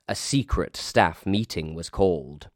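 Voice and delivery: ordinary delivery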